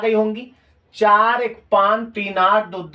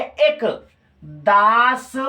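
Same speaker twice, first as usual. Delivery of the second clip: slow syllable count; much slower than usual. Shown as a demonstration. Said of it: "बोल के लगाना है".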